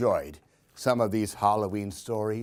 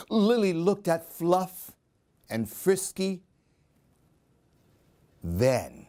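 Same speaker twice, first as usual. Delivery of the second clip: lively, sing-song storybook reading; animated picture-book read-aloud; reading to children; 2 semitones higher, slower than usual. A narrator's reading. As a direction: read it like story time for little ones.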